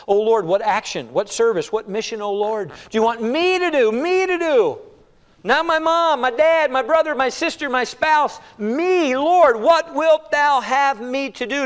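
high, loud, pressing plea, last line slow; earnest personal prayer. Acted. A believer pressing the Lord for his own task, high and loud. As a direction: insist it is me, Lord.

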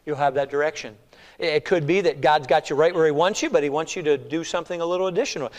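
normal talking voice

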